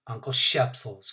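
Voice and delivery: usual speaking voice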